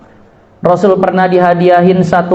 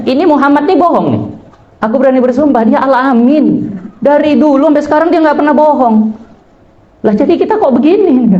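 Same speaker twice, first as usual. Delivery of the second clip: retelling Abu Jahal's oath; 7 semitones higher than usual. Retold or imitated.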